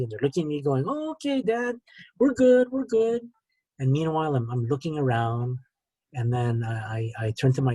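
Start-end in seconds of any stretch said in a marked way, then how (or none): 0.9-1.8 humouring reply. Retold or imitated. Family answering their dazed dad on a video call, high-pitched humouring. 2.2-3.2 high-pitched imitation of placating relatives; mock reassurance. Family telling sick dad they are fine, high-pitched reassurance.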